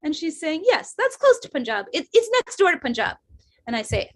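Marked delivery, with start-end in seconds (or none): 0.6-3.2 higher-pitched imitation of mom; mom with attitude. Mom correcting her interviewing daughter on geography, mock attitude. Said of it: "little taste of my mom's attitude".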